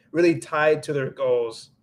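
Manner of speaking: ordinary talk, nothing marked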